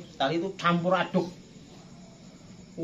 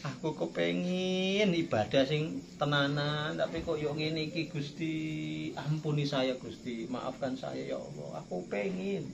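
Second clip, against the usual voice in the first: tearful pleading prayer; 3 semitones lower, 8 dB quieter than usual. Pretend. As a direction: beg quietly, drawing words out as if crying. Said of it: "Pernah nggak ngelakuin kayak gitu".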